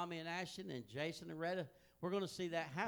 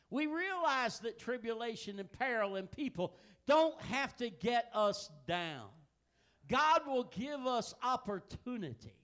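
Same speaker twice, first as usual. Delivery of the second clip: rising preacher's fervor, louder; preaching; 5 semitones higher, 5 dB louder than usual. Acted. Pastor exhorting his congregation, fervent preaching. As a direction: drive the point home from the pulpit.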